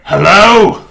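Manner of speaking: loud, high call through a door. Acted. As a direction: holler it to whoever is inside. Caller outside shouts through the door.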